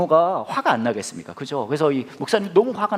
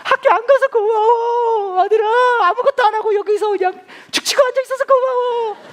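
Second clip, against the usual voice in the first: hammed-up doting father; 6 dB louder than usual. Father thanking his son for skipping school, mock tearful. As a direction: overdo the grateful teary dad.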